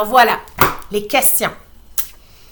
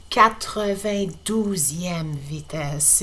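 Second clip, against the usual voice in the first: ordinary delivery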